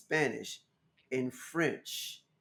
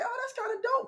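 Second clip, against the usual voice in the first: much higher, pleasantly surprised; high, delighted aside; impressed. Retold or imitated. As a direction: react to discovering something cool. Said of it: "And I was like".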